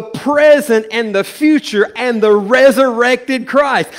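loud, impassioned sermon delivery; full preaching. Acted.

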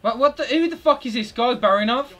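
loud, high, disbelieving yell; real furious outburst at the opposing player; outraged shout. Real, not acted. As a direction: blast the screen in outrage.

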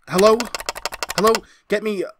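loud, high frantic call. Acted. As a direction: rush the number out in panic.

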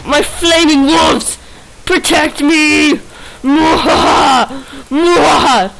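hammy villain shouting orders; mustache twirling villain. Pretend. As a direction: cackle like a cartoon villain. Commanding wolves, evil laugh.

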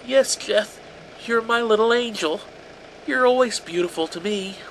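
high, loud, a touch of tearful soothing; sobbing mother. A narrator's reading. A terrified mother soothing her son, high and tearful. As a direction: placate him through tears. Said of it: "she sobbed".

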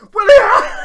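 screaming the name very high and loud; ecstatic scream. Real, not acted. Celebrating the goal against Real Madrid, screaming the scorer's name. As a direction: scream it as the ball goes in.

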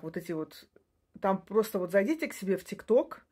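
ordinary talk

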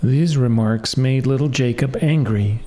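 ordinary delivery, nothing marked

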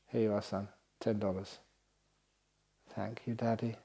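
father giving son money softly; soft exchange. Retold or imitated. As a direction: hand it over quietly, tenderly.

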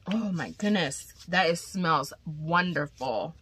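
normal talking voice

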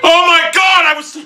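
loud, high cry of frustration; frustrated high outburst; exasperated yell. Real, not acted. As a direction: yell it as the game is lost. Gamer outburst after losing.

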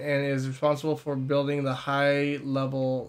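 measured, slow journal reading; reading a journal aloud. Acted. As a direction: read it like a scholar's notes. Reads in-game journal aloud.

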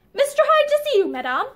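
servant announcing. A narrator's reading. Maid announcing a caller to Barbara, formal. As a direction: announce the visitor properly.

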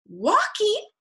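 high, astonished beaver; amazed animal voice; gasped. A narrator's reading. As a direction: gasp it high as a question. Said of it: "gasped Beaver".

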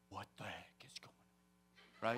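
hushed, awestruck disbelief; stunned whisper. Retold or imitated. Man in his car sees the sky light up, whispering stunned. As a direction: whisper it under your breath, dumbfounded.

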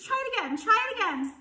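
very high, excited goose cry; urging on. A narrator's reading. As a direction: egg him on in a high voice. Goose cheering on the pig.

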